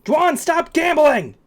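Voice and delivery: high, drawn-out loud holler; mock shout. Calling out Juan over gambling, mock shout. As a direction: yell it out slowly, high and loud.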